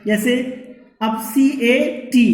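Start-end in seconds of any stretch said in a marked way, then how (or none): none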